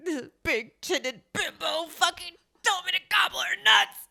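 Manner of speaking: high, rising mock rant ending in a shout; mock angry rant. Guy crying at home about the joke, mock shouted rant. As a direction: rant it like a wounded guy, yelling the end.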